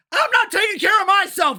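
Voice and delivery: shouted high, "myself" thrown back hard; angry shout. Real, not acted. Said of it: "I'm yelling all the time".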